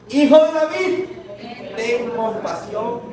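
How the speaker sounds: high, loud cry then a slow plea. Acted. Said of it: "Aún más fuerte".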